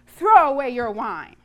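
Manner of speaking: loud, commanding rebuke; weighty, scolding command; stern demand. A narrator's reading. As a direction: order it forcefully as the priest. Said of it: "he demanded".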